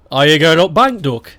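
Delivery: thick Stoke accent, going up bank. Shown as a demonstration. Asked to speak Stoke, greeting in Stoke accent. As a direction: say it in broad Potteries Stoke dialect. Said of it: "Please speak Stoke".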